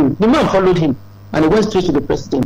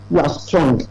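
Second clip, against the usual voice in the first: usual speaking voice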